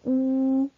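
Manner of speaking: "ou" held long on one pitch; drawn-out vowel. Shown as a demonstration. Teacher asking which letter makes the sound, "ou" drawn out long. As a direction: stretch the sound "ou" steadily. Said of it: "quelle lettre qui tire pour faire le son".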